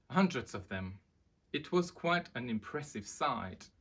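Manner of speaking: lively read-aloud voice; storybook narration. A narrator's reading. Reading a children's book aloud to viewers, lively. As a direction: tell it like bedtime to children.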